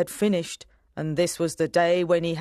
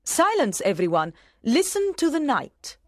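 high, imperious royal command; a queen silencing her court; 8 semitones higher than usual. A narrator's reading. Voices the Queen.

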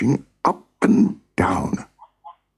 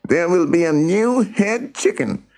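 angry yell; 13 semitones higher than usual. A narrator's reading. Mister Moan throws Bantam off the farm, yelling angrily. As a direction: banish them in a high-pitched rage. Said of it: "he yelled".

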